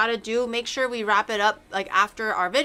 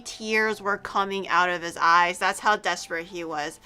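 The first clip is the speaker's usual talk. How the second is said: ordinary talk, nothing marked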